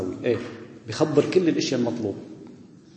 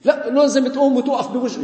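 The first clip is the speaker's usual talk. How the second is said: mock zealot; 9 semitones higher than usual. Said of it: "إذا بعدوك عن الإيمان".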